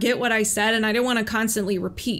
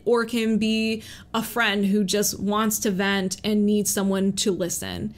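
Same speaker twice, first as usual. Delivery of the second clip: ordinary talk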